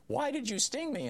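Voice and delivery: high, plaintive frog voice; dying frog. Retold or imitated. Dying frog asking the scorpion why, high and plaintive. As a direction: squeak it out as the betrayed frog.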